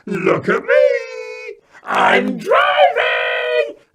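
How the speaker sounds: put-on very high, loud, slow gleeful child's yell; toddler shout. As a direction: scream with toddler delight. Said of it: "Eddie shouted out at us".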